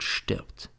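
breathy whispered last word; whispered threat. A narrator's reading. Teacher reading the diary's threat, whispered. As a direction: let the death threat fade to a whisper.

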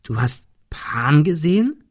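slow, steeply rising question; incredulous question. Acted. Ben questions Thomas about seeing Pan, incredulously. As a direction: draw it out in disbelief.

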